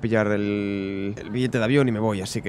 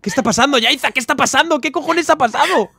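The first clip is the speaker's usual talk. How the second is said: panicked shouting; 13 semitones higher, 6 dB louder than usual. Acted. Panicked boyfriend yelling on phone.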